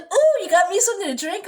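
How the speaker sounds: high, loud, delighted question; eager retold mom. Mom asking daughter for a drink, mock eager. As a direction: play up mom's eager "ooh".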